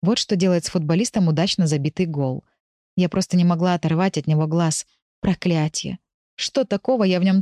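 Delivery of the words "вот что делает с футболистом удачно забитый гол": ordinary delivery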